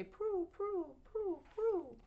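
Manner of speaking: high cooing pigeon voice. A narrator's reading. Reading to a child, the pigeons coo high. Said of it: "and they say".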